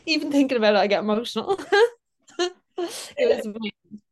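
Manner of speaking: tearful laughing through emotion; choked up, laughing and crying at once; real tears breaking through the words. Real, not acted. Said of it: "I'm crying on your podcast".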